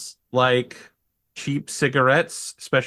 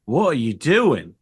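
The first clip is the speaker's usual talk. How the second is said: hammed-up bogan host; 7 semitones higher than usual. Bogan sergeant offering drinks to the intruder, hammed-up high. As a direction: lay on the Aussie mate act, pitched up. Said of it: "he's like".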